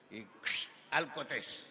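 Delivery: normal talking voice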